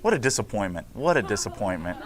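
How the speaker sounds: low, soft, let-down sigh of a line; mock disappointment. Teacher mock-sighs at silent student, softly let down. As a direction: act crushed by the missing music. Student failed to play theme music.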